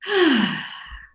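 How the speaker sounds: a long, high, relieved sigh; contented sigh. Retold or imitated. Guest imitating her body at the word sleep with a contented sigh. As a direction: let the body sink into a happy sigh. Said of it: "my body just goes".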